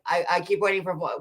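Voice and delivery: usual speaking voice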